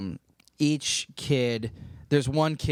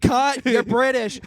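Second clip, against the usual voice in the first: higher, slow called-out correction; mock film director; 11 semitones higher, 6 dB louder, slower than usual. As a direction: interrupt the take like a director. Voicing director correcting actress.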